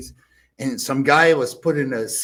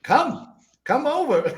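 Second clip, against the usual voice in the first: calling out; 11 semitones higher, slower than usual. Retold or imitated. Franz calling the girl over.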